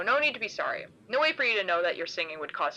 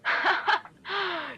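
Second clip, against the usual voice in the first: a long loud mocking laugh; burst of laughter; 10 semitones higher than usual. Figure laughing at the girl, long and loud. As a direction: throw back a big teasing laugh. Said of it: "He bursts out laughing, long and loud".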